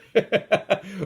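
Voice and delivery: high, short burst of chuckling; laugh. Real, not acted. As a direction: crack up at your own comeback. Laughs after retold comeback.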